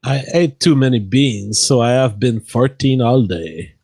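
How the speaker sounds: [name] says it in his accent on request; performed on request. Shown as a demonstration. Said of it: "I want J F to say this".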